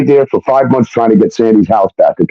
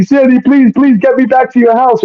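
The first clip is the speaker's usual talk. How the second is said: high-pitched mock begging; put-on high, urgent pleading; pleading caller; 12 semitones higher than usual. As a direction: imitate a desperate plea in a much higher voice. Retold pleading phone call.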